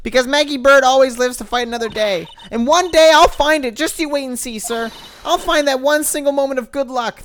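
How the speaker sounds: dogged optimist cop rallying herself; Maggie voiced. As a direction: play the cheerful hapless cop, bright and loud. Reading Maggie's lines in voice.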